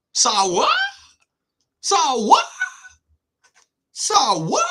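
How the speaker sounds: high, rising questioning echo of the name; mock disbelief. Repeats name as mock-shocked question.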